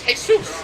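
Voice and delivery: Jesus said the Spanish way, hay-SOOS; Spanish pronunciation. Shown as a demonstration. Preacher explaining the Spanish name, saying Jesus as hay-SOOS. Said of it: "that's Spanish for Jesus".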